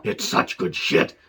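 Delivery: low, unvoiced gloating whisper; Vince McMahon whisper. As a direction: hiss it like a scheming boss. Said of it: "I'm Vince McMahon".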